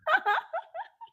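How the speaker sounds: squeaky little laugh; high laugh. Real, not acted. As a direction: giggle up high. Laughs at viewer comment.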